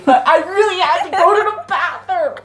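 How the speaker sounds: loud, high, laughing insistence; laughing protest. Real, not acted. Defending the bathroom trip to friend, laughing protest. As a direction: insist through real laughter.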